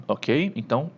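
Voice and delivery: ordinary delivery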